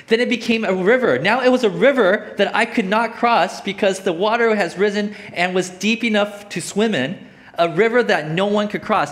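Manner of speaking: loud, high, emphatic verse reading; scripture reading. Acted. Preacher reading to the congregation of the uncrossable river, forceful scripture reading. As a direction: proclaim the verse with rising force.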